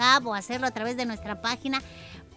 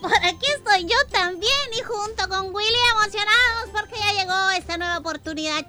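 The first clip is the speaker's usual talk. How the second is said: excited; 7 semitones higher, 5 dB louder than usual. Acted. Co-host joins excitedly.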